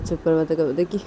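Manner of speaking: plain reading voice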